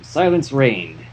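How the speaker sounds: plain reading voice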